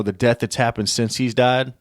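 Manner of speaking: ordinary delivery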